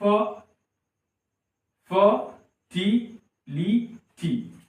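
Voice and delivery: Fertility very slowly, fer, ti, li, ty; long gaps between the syllables of fertility; four isolated beats for fertility. Shown as a demonstration. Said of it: "यहाँ पे चार syllable हो रहा है".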